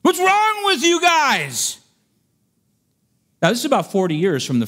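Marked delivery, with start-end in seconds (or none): 0.0-1.8 high, slow, roaring rebuke; furious shout. Acted. Moses shouting at the rebellious people, slow furious yell. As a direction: blast the rebels with rage. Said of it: "he's shouting at these people".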